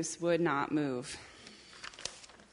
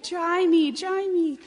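put-on high, loud, pleading baby voice; crying baby chick; 11 semitones higher, 9 dB louder, slower than usual. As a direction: wail a demand like a soggy chick. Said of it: "cried the soggy baby chick".

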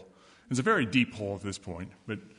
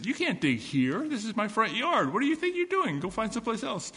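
high, put-out little worm voice; indignant worm; 9 semitones higher than usual. Acted. Worm scolding the girl digging in his yard, high and indignant. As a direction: scold her like a fussy tiny creature. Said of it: "And the worm says".

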